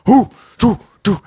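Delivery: short high marching hoots; punchy chanted "hoo"; imitated marching grunts. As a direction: mimic troops' rhythmic grunts. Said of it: "they were making, like, the".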